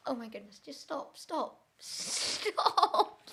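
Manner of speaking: very soft, defeated pleading; exasperated murmur. Real, not acted. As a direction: beg under your breath. Exasperated, giving up.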